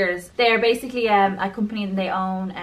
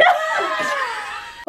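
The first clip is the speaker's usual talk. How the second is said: high burst of laughter. Real, not acted. Laughs after the attempt.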